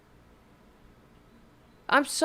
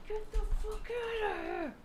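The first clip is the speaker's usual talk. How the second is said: high, disbelieving get out; 13 semitones higher, 10 dB quieter than usual. Real, not acted. Reacting in disbelief to clip.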